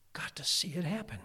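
breathy whispered longing. Real, not acted. Preacher whispering his longing to see the sea part. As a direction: whisper it like a secret wish.

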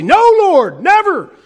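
high, loud indignant no; Peter's loud shocked protest to the Lord. Retold or imitated. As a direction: cry it out in refusal.

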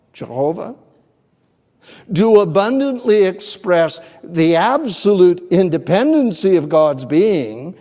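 ordinary delivery

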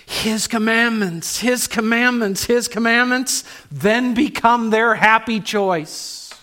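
his commandments, his commandments, preached loud and high; fervent climax. Real, not acted. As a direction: hammer the repeats with joy. Preacher's climax after hymn lines.

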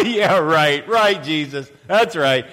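hammed-up jeering laugh into loud sarcasm; mocking laughter. Preacher acts mourners ridiculing Jesus, mocking laughter. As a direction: laugh it off scornfully, then jeer. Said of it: "start laughing at Jesus. They ridiculed him".

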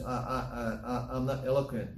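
halting stammer on the first word; hesitant Moses. Acted. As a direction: stumble nervously into it, repeating the first sound. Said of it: "He's very hesitant".

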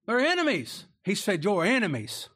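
harsh accusation. Retold or imitated. Joseph accusing brothers harshly.

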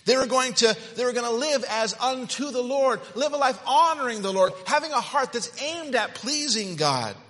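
high, loud preaching fervor; fervent preaching. Acted. Preacher urging the congregation, loud and high. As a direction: preach it out with fire.

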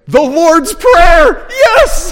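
high, loud cheer of delight; triumphant shout. Real, not acted. Preacher cheering the congregation's answer, triumphant shout. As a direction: shout it slowly and high, celebrating.

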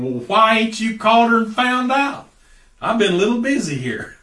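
high, loud, indignant comeback; exasperated retort. Retold or imitated. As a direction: imitate your own fed-up reply to the deacon. Retorting in thought to a deacon.

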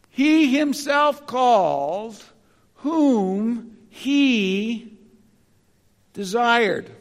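"he himself called" slowed, each word leaned on; stress on he himself. Shown as a demonstration. Pastor retranslates the verse to the congregation, slowly stressing "he himself". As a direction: press hard on "he himself" so the calling is his alone. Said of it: "putting great emphasis on the fact that he's the one".

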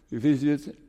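usual speaking voice

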